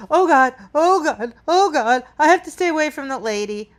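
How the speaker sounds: fast, mock-frantic "Oh God, oh God"; a dog in mock panic fleeing the lady. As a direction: rush it like a nervous dog, hammed-up. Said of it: "he wasn't like".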